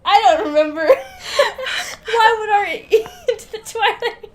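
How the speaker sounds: words breaking into high giggles; laughing so hard she can barely speak. Real, not acted. Co-host cracking up over the scam email, laughing through words.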